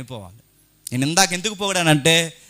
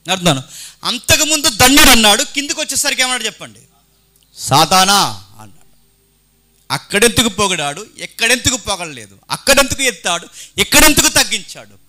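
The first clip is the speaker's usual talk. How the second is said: loud, high preaching cry; fiery preaching; 6 semitones higher, 11 dB louder than usual. Acted. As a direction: preach it out to the hall at full force. Preaching at full force. (సభకు బిగ్గరగా ఉద్వేగభరితమైన ప్రసంగం)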